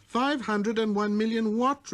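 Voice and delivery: put-on a curious boy calling out; little prince. Prince voiced.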